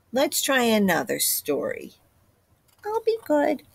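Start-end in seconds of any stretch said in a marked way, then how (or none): 2.8-3.6 put-on child voice. Chick promising.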